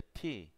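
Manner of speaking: "T" said as English "tee"; aspirated T. Shown as a demonstration. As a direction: release "T" on a puff of breath. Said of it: "como pronuncia T".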